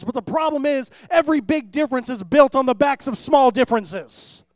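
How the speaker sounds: loud sermon delivery with punched key words; emphatic preaching. Real, not acted. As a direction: drive the point home to the congregation. Preacher drives the point home.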